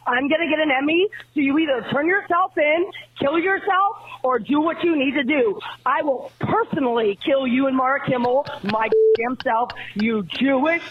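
ranting furious phone message; angry voicemail. Real, not acted. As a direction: spit out a raging voicemail. Great moments in angry voicemail history.

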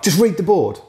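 a stern teacher brushing off the pupil; impatient teacher. Retold or imitated. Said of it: "it would just be like".

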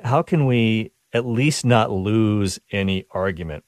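ordinary delivery

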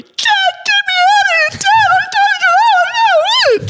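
put-on high, loud panicked child's scream; terrified child screaming. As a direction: scream for dad like a petrified kid. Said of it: "screaming, crying, going".

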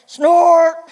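big loud drawn-out "snort" noise; loud mock snort. Mom reading aloud plays the big thing, loud playful snort. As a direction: blare it out like a huge machine. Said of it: "this is the fun part as a mom where you get to go".

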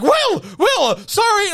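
high, loud, rushed apology; a high flustered sister apologising. Retold or imitated. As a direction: squeak out a frazzled sorry. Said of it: "She's like".

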